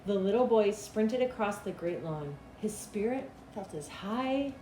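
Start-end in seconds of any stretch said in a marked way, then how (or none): none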